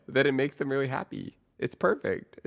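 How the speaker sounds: delighted, pitch climbing up; gleeful. Real, not acted. Gleeful about failing.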